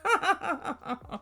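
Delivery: high rippling laugh; amused cackle. Real, not acted. Host laughing at mum's wish, amused cackle. Laughs after quoting Summit's mum.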